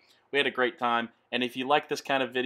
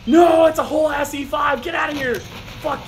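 high loud yell; angry shout; 13 semitones higher, 9 dB louder than usual. Real, not acted. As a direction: shout at the enemy. Angry at tank shot.

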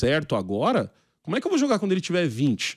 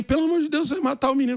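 mother pleading, pitched much higher; high-pitched mock of a panicked mom; frantic mother; 9 semitones higher than usual. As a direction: imitate mom begging him to stop, voice way up. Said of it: "E minha mãe".

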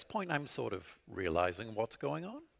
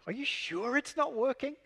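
much higher, innocent disbelief; feigned surprise; 12 semitones higher than usual. Retold or imitated. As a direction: play dumb to stall the client. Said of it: "This is my stalling tactic".

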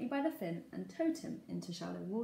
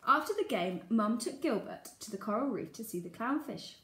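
ordinary delivery, nothing marked

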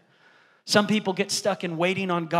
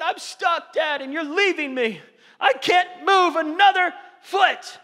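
put-on high, loud child voice; panicked son; 9 semitones higher, 5 dB louder, slower than usual. Imitating his panicked son stuck on the rocks. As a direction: cry out stuck and scared.

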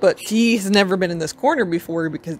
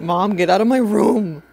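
voicing the pet as teen; 2 semitones higher, 6 dB louder than usual. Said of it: "this is just the ultimate".

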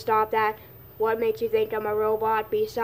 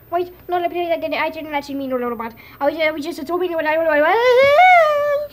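fast, higher frantic begging; panicked pleading; 5 semitones higher, 8 dB louder than usual. Pretend. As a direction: beg for your life in a rising scream. Begging not to be killed.

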